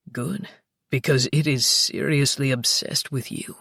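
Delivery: deep teasing lover; low playful male voice. Acted. Luca voiced.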